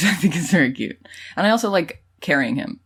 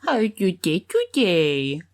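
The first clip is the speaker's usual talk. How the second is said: hammed-up baby talk, pitched up; 4 semitones higher, slower than usual. Mocking the baby voice way in pitched-up baby talk. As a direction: babble like someone cooing at an infant. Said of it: "like, baby voice way".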